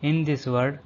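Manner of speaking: normal talking voice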